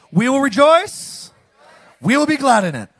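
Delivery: preacher rousing the congregation to join in; rousing exclamation. Real, not acted. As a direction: lift the congregation with it. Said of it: "Say it with me".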